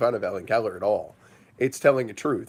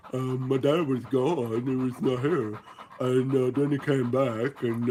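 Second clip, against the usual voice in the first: Chunk the dog. Acted. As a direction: speak as a simple, chatty puppy. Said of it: "That's my dog. Love it, Chunk".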